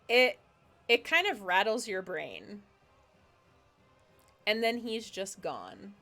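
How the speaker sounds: ordinary talk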